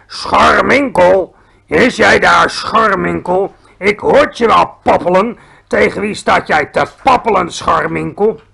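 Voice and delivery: booming, very loud giant bellow; thunderous shout. A narrator's reading. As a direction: roar it out like a thunderclap, drawing out the name. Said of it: "schreeuwde er een stem als een donderslag".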